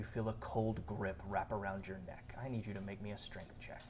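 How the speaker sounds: whisper. Acted. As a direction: breathe it like something unseen at your neck. GM voicing the ghost's grip.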